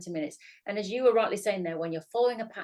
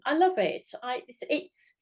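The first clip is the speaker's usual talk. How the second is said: ordinary talk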